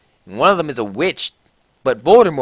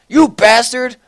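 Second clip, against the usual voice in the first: put-on furious yell at the cheating boyfriend; high, loud angry scream; angry shout; 9 semitones higher, 8 dB louder than usual. A narrator's reading. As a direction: bellow it, outraged. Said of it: "I shouted angrily".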